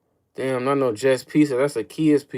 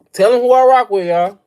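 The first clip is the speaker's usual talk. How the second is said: higher, louder shout to the chat; louder hyped callout; 7 semitones higher, 8 dB louder than usual. Real, not acted. Streamer yelling his team loyalty at chat. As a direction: belt it out.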